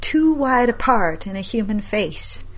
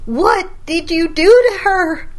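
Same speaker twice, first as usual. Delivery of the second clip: much higher, louder, slow demand; grandma demanding answers from grandson; horrified accusation; 11 semitones higher, 5 dB louder than usual. Acted.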